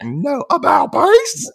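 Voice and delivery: loud, high, drawn-out command; drill sergeant. Acted. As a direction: bark the order like a cartoon sergeant. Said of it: "But the drill sergeant's like".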